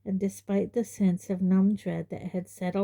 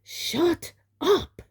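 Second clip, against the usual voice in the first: shrill furious roar, pitched much higher; 11 semitones higher, 4 dB louder than usual. Acted. Uncle and aunt silencing Harry, a high furious roar. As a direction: bellow them into silence. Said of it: "roared Uncle Vernon and Aunt Petunia".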